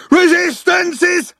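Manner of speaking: very high, drawn-out slogan shout; bellowed. A narrator's reading. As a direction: bellow slowly with full lungs. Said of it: "filled his lungs and bellowed".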